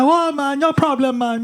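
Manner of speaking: high-pitched, easy-going imitated Jamaican; mock Jamaican Rasta reply; Jamaican accent. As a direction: brush off thanks in a put-on Jamaican accent. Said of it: "really thick Jamaican accent".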